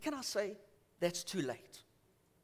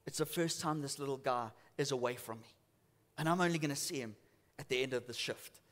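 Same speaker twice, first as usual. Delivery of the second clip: subdued confiding, retold; 6 semitones lower, 5 dB quieter than usual. Upset cashier confiding about her son.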